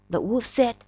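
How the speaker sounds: usual speaking voice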